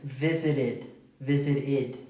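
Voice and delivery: visited with the ending as a clear id. Shown as a demonstration. Teacher modeling visited for students, ending id. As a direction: say visited ending in a clean id sound. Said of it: "Se dice id".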